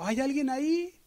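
very high, calling out far; the hanging boy shouts up from the canyon for anyone; shouted call. Retold or imitated. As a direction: yell up from the canyon for help. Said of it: "de repente gritó".

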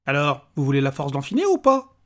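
lightly voiced pushy little man; impatient little stranger. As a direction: press for an answer.

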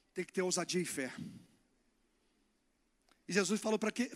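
usual speaking voice

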